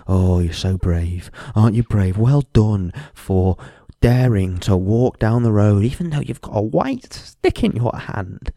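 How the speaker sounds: slow, sneering mock praise; mocking patronising voice. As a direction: drag it out like a condescending stranger. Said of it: "patronise me by saying".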